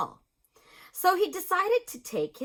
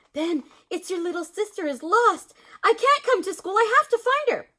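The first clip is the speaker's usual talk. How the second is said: worried mother; 10 semitones higher, 5 dB louder than usual. A narrator's reading.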